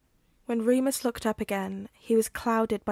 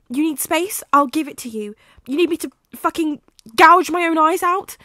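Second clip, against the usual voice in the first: high, loud, spiralling offers; panicked boyfriend begging, getting louder; frantic desperate pleading; 6 semitones higher, 11 dB louder than usual. Acted. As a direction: throw everything at him, near shouting.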